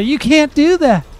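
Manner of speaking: high, loud nagging mock voice; hammed-up outraged know-it-all; mocking whiny people. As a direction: squeal it like a scandalized critic. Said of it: "people will still say".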